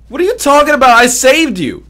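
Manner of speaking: high, loud mock indignation; indignant protest. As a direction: yell back as the wronged rescuer. Protesting to fleeing game children.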